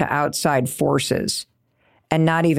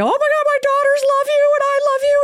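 much higher, fast, starstruck squeal; gushing excitement; faster than usual. Retold or imitated. Host gushing to her makeup idol, much higher and excited. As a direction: gush like a starstruck fan. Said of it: "and then I go".

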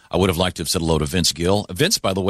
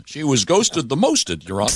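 put-on Texas accent; 6 semitones higher than usual. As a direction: lay on a light Texas accent. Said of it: "That was my Texas accent".

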